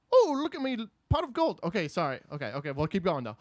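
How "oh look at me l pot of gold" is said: high-pitched stock leprechaun Irish; Irish accent. Acted. Actor warming up his Irish accent, silly high voice. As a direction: put on a silly, higher Irish voice. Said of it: "Doing, like, an Irish accent?".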